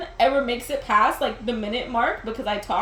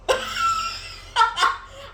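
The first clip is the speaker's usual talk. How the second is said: squealing laugh. Real, not acted. Squeal after the question.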